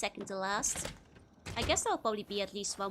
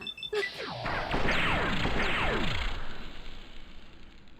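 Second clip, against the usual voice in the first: loud high laughter; 13 semitones higher, 5 dB louder than usual. Real, not acted. Cracking up at own joke.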